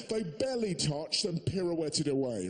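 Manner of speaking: usual speaking voice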